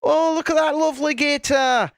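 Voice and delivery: high-pitched cooing over an animal; mock fussing. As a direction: fuss over it like a cute pet. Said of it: "you'd go fussing over 'em".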